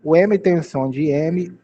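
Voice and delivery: ordinary talk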